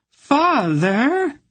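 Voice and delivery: a daughter's dramatic cry to her father; hammed-up wailing father. A narrator's reading. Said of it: "Anhelde wailed".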